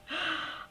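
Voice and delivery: sudden gasp. Real, not acted. Notices something, gasps.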